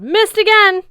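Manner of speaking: high, loud mocking yell; taunting yell. A narrator's reading. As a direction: rub his nose in it. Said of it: "and I yelled".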